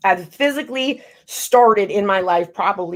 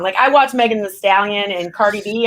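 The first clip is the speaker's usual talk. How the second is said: ordinary talk, nothing marked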